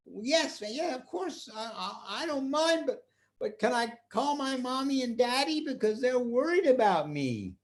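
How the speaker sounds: put-on high, stammering child's voice; anxious to please; eager little boy. Boy asking the magician to call his parents, high eager voice.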